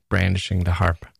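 ordinary delivery, nothing marked

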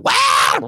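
loud wild "wah" squawks; crazy kid noises. Retold or imitated. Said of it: "The kids are crazy".

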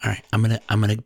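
soft ASMR voice into left ear; ASMR whisper. Pretend. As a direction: hush it close to the mic. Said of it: "A S M R mode".

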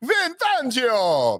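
high, slow, hammed-up Italian Mario voice; Mario accent. Sports podcast host says the coach's name as Mario. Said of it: "Why am I talking like Mario?".

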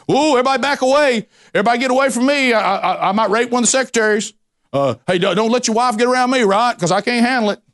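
high, fast sarcastic mockery of being corrected; mocking scoffer. Preacher mimics a scoffer sneering at correction, mocking. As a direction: ham up a scoffer brushing off a rebuke. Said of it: "They make fun of it".

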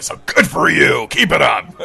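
put-on hearty approval; approving man. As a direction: cheer him on as the bathroom guy. Bathroom man congratulates him.

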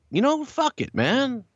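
high-pitched impression of giving in; put-on high voice, conceding; Vince's voice. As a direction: imitate a boss relenting, voice pushed way up.

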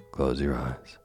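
plain reading voice

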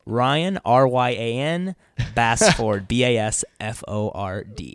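slow, spelled out letter by letter; clarifying. Shown as a demonstration. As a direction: say "Bassford" with a full FORD, then spell it clearly. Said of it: "I like to clarify".

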